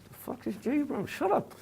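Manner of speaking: high squeaky mock protest; mock squeak. High squeaky aside about Jay, mock panic. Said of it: "I'm like".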